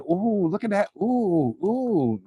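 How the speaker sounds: high, light oohing; mock gawking tourist. Mocking Southern visitors in New York, gawking oohs. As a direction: imitate a visitor marvelling at the sights. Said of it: "wanna walk around and be like".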